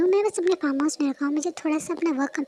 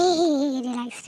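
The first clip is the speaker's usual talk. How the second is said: ordinary delivery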